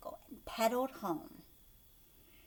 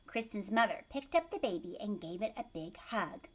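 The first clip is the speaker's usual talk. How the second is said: plain reading voice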